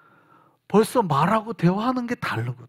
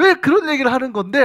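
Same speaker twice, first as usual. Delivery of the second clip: impatient question; 6 semitones higher, 6 dB louder than usual. Retold or imitated. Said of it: "하고 한참 얘기하다 보면".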